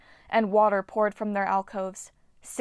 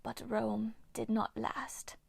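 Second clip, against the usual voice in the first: slow whispered snarl of a bitter nymph; 8 dB quieter, slower than usual. Acted. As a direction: spit it out slowly, full of old grudge. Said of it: "Hagno snarled".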